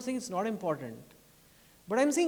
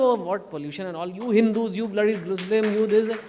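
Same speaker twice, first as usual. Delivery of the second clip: mock quarrel; 10 dB louder than usual. Mocking election-time quarrel.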